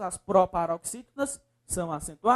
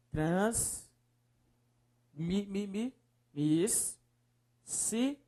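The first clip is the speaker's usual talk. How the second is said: syllable by syllable; 6 dB quieter, much slower than usual. Shown as a demonstration. Teacher separating the word for students, syllable by syllable.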